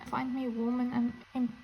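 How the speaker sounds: usual speaking voice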